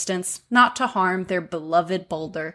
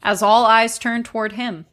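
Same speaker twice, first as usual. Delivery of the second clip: normal talking voice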